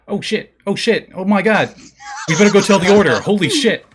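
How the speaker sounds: high, loud, frantic bird voices; panicky ravens. Acted. Ravens panicking after hearing the wolves, high and loud. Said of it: "you hear the ravens being like".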